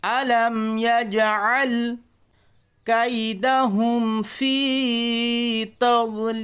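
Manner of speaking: teacher demonstrating the full verse, chanted; melodic recitation. Shown as a demonstration. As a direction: chant it slowly, drawing out the vowels. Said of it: "আরেকবার দেখেন".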